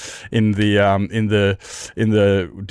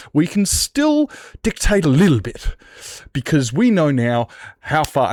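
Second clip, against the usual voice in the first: mock scheming government; 6 semitones higher than usual. Mock government plotting control.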